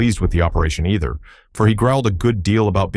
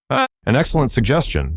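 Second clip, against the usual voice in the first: approving; 7 semitones higher than usual. A narrator's reading. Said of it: "said the Wogglebug approvingly".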